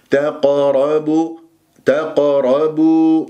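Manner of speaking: تقربوا chanted slowly with a full, heavy ر. Shown as a demonstration. Teacher models the word for students, heavy ra. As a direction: thicken the ra in تقربوا. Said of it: "الراء مفخمة لين مفتوحة".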